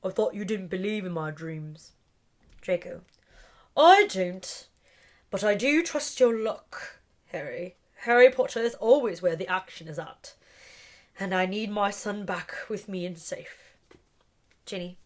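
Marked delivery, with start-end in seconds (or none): none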